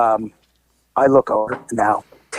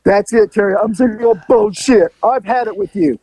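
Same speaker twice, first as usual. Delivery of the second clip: higher, fed-up outburst; fed-up neighbor; 6 semitones higher than usual. Retold or imitated. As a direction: storm off in a huff. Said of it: "she goes".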